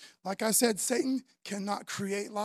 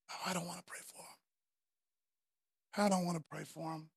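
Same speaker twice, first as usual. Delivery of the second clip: reluctant; 5 semitones lower, 8 dB quieter than usual. Retold or imitated. Reluctant self voiced in sermon.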